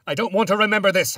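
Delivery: high, loud frantic scream; screamed refusal. A narrator's reading. Narrator to the ghost of his wife, refusing the memory, screamed. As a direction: scream it out fast, far above your normal voice. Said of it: "I screamed at her".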